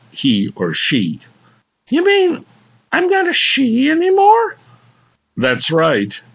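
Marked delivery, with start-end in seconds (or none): 1.9-4.6 high woman voice. Acted. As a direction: protest like a lady. Woman voice shocked.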